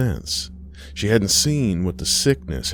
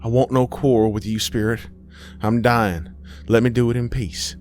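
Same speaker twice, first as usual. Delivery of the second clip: higher, hurried, weary protest; dying woman pleading; 5 semitones higher, faster than usual. Acted. Aisha begs the spirit to leave her, urgent pleading. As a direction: plead to be left alone to die.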